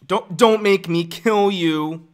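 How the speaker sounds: dramatic brooding threat, drawn out; Anakin. Acted. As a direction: threaten loudly, stretching every word. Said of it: "no, I gotta do Anakin".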